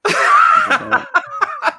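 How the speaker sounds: high-pitched laughing fit. Real, not acted. Laughs at the airport scene.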